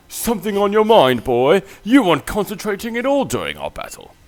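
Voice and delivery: loud, high, pompous villain voice; haughty noble brother. Acted. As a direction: sneer down at the child from on high. Voices haughty brothers from game dialogue.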